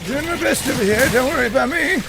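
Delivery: loud strained. Real, not acted. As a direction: call out over the fight. Shouting during combat.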